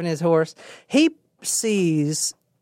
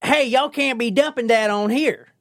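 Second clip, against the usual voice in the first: higher-pitched Southern-style call; calling from afar; 6 semitones higher than usual. Acted. Truck driver to people far off, calling out. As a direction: call across a distance with a folksy twang. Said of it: "He says that from afar".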